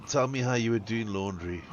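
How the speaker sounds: normal talking voice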